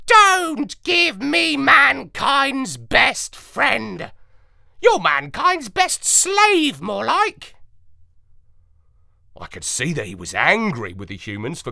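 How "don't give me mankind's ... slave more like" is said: high, loud, slow sneering parrot; scornful parrot retort. A narrator's reading. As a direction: drag out the scorn, bitter at mankind. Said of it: "and he retorted".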